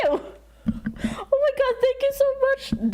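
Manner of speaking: stunned and grateful, stretch every word; overwhelmed thanks. Real, not acted. Streamer thanking a donor, overwhelmed and high.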